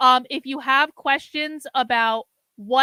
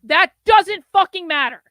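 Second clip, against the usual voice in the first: louder, higher, real anger; angry outburst; 4 semitones higher, 7 dB louder than usual. Real, not acted. Streamer scolding his chat in real anger. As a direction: snap it out, furious at the chatter. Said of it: "the reason I'm getting angry now".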